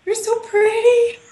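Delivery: high, slow, loud cooing with a laugh; cooing at baby. Retold or imitated. Author coos at her new book like a baby. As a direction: coo over it like a newborn baby. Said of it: "And this is what I did".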